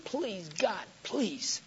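soft plea, pitch swooping up and falling; re-enacted hushed imploring; begging God. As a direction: plead quietly, drawing out each please. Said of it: "as I begged God".

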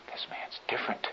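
hushed, breathless whisper; whispered inner thought; awed whisper. Acted. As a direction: breathe it to yourself in awe.

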